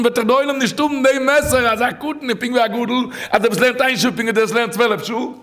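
high-pitched urgent sermon delivery; impassioned preaching. Real, not acted. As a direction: preach it high, driving the point home. (פייערדיגע דרשה, הויך און דרינגענד)

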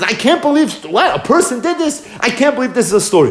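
high-pitched, indignant outburst; angry disbelief. Acted. King hearing the story of the stolen sheep, upset and angry. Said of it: "He was visibly angry".

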